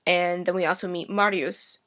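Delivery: normal talking voice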